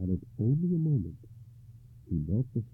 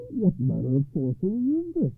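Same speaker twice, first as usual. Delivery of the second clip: shocked cry, high and loud; startled, raised-voice demand; hoarse cry; 11 semitones higher, 7 dB louder than usual. A narrator's reading. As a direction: cry out in alarm, pitched up. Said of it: "he cried hoarsely".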